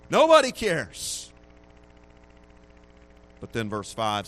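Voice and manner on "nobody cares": emphatic cry. Acted.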